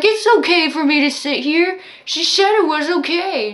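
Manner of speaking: mock the drunk who won't move; drunk old man protesting.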